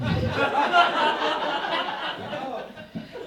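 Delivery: high, giggly chuckle; giggle at own joke. Pretend. Comic giggling at his own notebook joke. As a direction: giggle like you find yourself hilarious.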